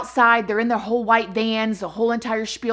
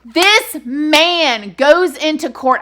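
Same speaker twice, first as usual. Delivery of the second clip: slow, louder, emphatic build; hyped up; 4 semitones higher, 6 dB louder, much slower than usual. Real, not acted. Host telling listeners about the trial, real hyped emphasis. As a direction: lean in hard on every word. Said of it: "I'm getting so hyped".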